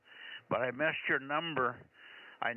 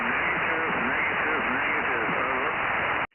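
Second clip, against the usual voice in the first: crackly military radio; 7 dB louder than usual. Real, not acted. Voice received over the radio.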